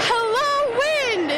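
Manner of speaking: a broken high cry into the storm; cracked desperate shout; crying out to the wind. Acted. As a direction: yell it at the wind, half mad. Yelling at the wind.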